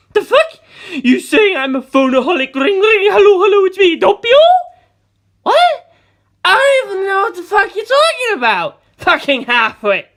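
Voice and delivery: high, loud manic screaming; crazed rant. Acted. Doppio screaming back at Mister, unhinged. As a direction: rant like a madman.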